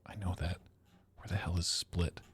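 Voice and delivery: breathy whisper to himself; whispered question to himself while puzzling over the map. Real, not acted. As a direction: whisper it under your breath.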